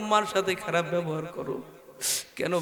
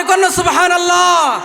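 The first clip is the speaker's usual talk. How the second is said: louder, higher shout to the crowd; loud call; 12 semitones higher, 12 dB louder than usual. Real, not acted. Preacher urging the crowd to shout louder. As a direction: rouse the crowd to shout back. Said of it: "চিৎকার দিয়ে".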